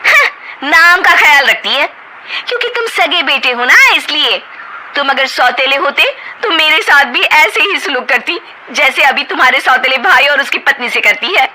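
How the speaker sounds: bitter ultimatum. Acted. Wife ultimatum.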